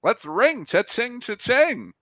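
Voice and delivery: high, slow gleeful cashing in. Retold or imitated. Seller cashing out shares, high gleeful cha-ching. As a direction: crow it like ringing up a sale, high and gleeful. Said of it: "It's like".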